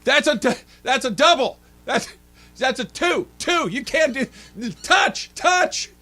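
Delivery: high, loud mock shout at the referee; wife yelling at ref. Wife yelling at the volleyball ref, loud. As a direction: holler the violations at the ref. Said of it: "Susan yells at referees".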